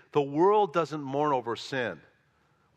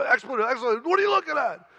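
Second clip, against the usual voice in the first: much higher, much louder mock yell; retold screaming; 9 semitones higher, 5 dB louder, faster than usual. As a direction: bellow it like the angry man on the subway. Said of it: "started swearing at me".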